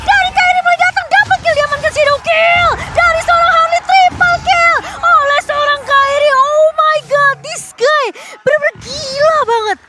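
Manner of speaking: high, hyped play-by-play shout; fighting game announcer. Real, not acted. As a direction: call the triple kill at full hype. Play-by-play climax of triple kill.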